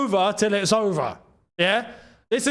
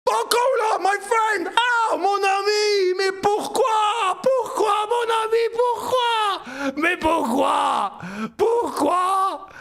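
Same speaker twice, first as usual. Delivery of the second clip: high, slow, hammed-up French lament; mock French wail; 11 semitones higher, slower than usual. Fan wailing in French at a wasteful player. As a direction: wail at him like a heartbroken Frenchman.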